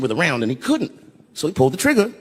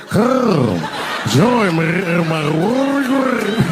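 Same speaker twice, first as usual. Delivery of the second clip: very slow, drawn-out throaty gargle, higher and louder; rolled throat; 5 semitones higher, 4 dB louder, much slower than usual. Shown as a demonstration. Joke book line redone with a rolled throat, eerie throaty drawl. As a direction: roll your throat through every word. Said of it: "you gotta roll your throat".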